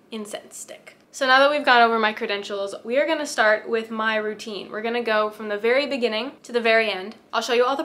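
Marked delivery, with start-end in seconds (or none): none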